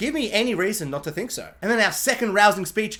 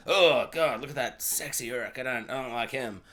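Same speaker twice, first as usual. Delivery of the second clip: much lower, much softer grumbling orc voice; 9 semitones lower, 7 dB quieter than usual. Pretend. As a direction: mutter like a jealous orc in the back. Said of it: "all the other Uruks are like".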